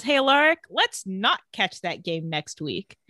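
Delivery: drawn-out, slow, teasing echo of the invite; slow it down and sneer; a dry, slowed parody of the friendly line; slow mocking invite. Damon mocks Mason's invite.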